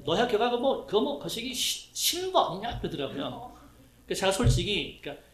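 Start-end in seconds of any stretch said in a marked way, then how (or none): 0.0-2.8 high, hesitant fumbling for a school name; a father groping for a word he can't recall; rising unsure guess from an absent-minded father. Retold or imitated. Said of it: "아버지 하는 말이 뭐냐면은".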